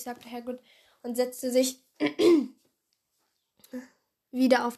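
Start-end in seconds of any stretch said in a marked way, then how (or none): 2.0-2.5 loud throat clearing; short rasping cough to clear the voice. Real, not acted. Reader's voice is getting hoarse.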